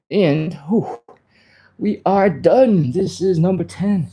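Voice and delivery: breathless and puffing, pitch jumping up; winded, pushing through the last count; out of breath. Real, not acted. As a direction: gasp it out after the reps. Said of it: "I'm a little bit out of breath".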